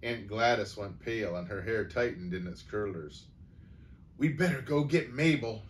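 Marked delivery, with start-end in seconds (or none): 4.2-5.6 higher, voiced mock whisper; urgent stage whisper. A narrator's reading. Frightened aunt urges getting help, urgent stage whisper. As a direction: urge her on in a pinched, voiced stage whisper. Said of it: "she whispered".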